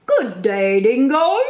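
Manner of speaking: loud, high, cheery character greeting; platypus voice. Acted. Platypus greeting the dingo in the story, loud and cheerful. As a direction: call out brightly as the platypus. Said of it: "he said, snapping his bill".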